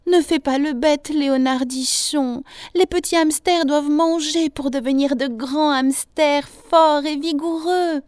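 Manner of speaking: high, insistent motherly lecture; scolding mother. A narrator's reading. Hamster mother urges her son to eat, high scolding.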